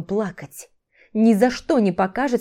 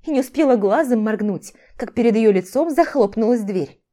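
plain reading voice

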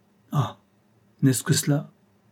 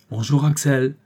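joyfully; 3 semitones higher, 3 dB louder than usual. A narrator's reading. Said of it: "fit-il joyeusement".

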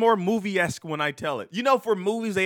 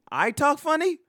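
mock indignant; 11 semitones higher, 5 dB louder than usual.

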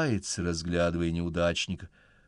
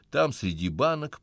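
ordinary delivery, nothing marked

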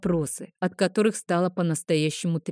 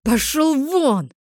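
высокий медленный громкий чудовищный рёв; рыкни как дракон в ярости; нечеловеческий рёв; 7 semitones higher, 4 dB louder, slower than usual. A narrator's reading. Нейтан ревёт, сбрасывая человеческий облик. (чудовищный драконий рёв)

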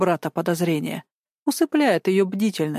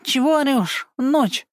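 grumbling; 6 semitones higher, slower than usual. Acted. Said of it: "недовольно буркнул".

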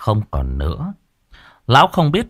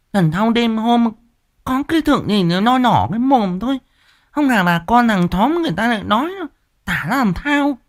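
much higher, sharp scolding wife voice; nagging old wife; 13 semitones higher than usual. A narrator's reading. Wife scolds husband for his fright, higher nagging voice. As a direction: fuss and scold like a village wife.

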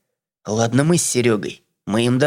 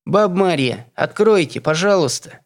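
calling out; 8 semitones higher than usual. Acted. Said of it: "крикнула за дверью девушка".

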